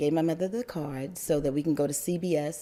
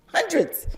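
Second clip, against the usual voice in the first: Hundreds said high and loud, stressed; 12 semitones higher, 4 dB louder than usual. Real, not acted. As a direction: punch Hundreds in amazement. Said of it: "Maybe not hundreds".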